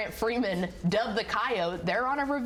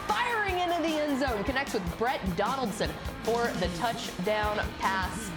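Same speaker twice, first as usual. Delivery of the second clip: highlights play-by-play; 2 semitones higher than usual. Real, not acted. Announcer narrating Whitesboro's highlights against Paradise, rising play-by-play. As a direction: build from scene setting to a soaring, stretched call.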